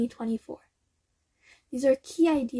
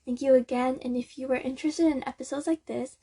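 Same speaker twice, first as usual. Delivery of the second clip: ordinary talk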